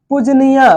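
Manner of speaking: पूजनीया with an added final 'ya'; wrong pronunciation. Shown as a demonstration. Teacher showing the faulty word, with extra 'yaa' ending. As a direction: end पूजनीया on a full aa. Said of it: "ये गलत है".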